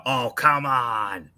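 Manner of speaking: long, drawn-out mock groan of protest; exasperated outburst. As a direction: stretch it out in exasperation. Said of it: "you hear me do the".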